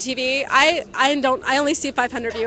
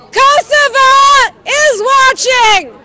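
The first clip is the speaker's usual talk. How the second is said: shouting; 12 semitones higher, 10 dB louder, much slower than usual. Real, not acted. Calling out to distant crowd.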